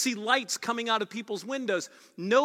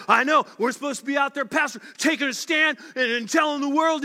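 high, loud, fast hammed-up voice of an eager believer; mock eager churchgoer; 4 semitones higher, 5 dB louder, faster than usual. Churchgoer mocking zeal to the pastor, loud and fast. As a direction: parody a zealous listener talking to the pastor. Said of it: "And you say".

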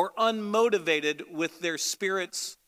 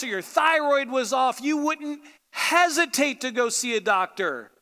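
usual speaking voice

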